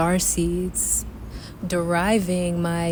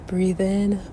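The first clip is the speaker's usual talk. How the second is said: guided meditation; 4 dB quieter than usual. Acted. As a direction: guide the breath calmly. Leads a breathing meditation.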